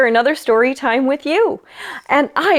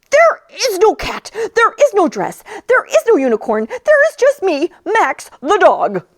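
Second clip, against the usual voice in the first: higher, emphatic dog voice; dog putting his foot down; exasperated dog; 8 semitones higher than usual. Acted. Dog Max declares.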